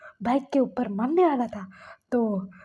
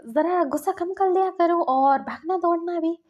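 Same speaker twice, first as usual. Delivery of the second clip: higher, louder teasing call; parting tease; 6 semitones higher, 5 dB louder than usual. Acted. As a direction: toss back cheeky advice as you leave. Acted parting tease from the bike.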